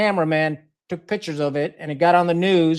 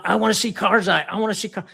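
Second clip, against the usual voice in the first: lightly imitated tantrum, pitched higher; mild mock tantrum; 4 semitones higher than usual. Storyteller imitating the diplomat demanding to see Karzai, mild mock tantrum. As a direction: act out someone whining through a tantrum, half in earnest. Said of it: "subside a tantrum".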